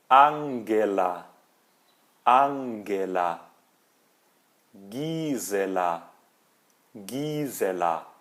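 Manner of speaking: Angela and Gisela with a hard G, slow; German hard G. Shown as a demonstration. Teacher drilling German hard G on names. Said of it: "G em alemão tem som de G U".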